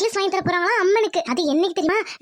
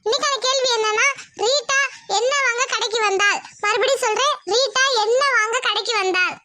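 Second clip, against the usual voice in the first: upbeat host addressing the audience; lifted, direct announcer delivery for a viewer question; presenter's quiz read; 6 semitones higher than usual. Real, not acted. Host asking viewers the episode's quiz question, presenter style.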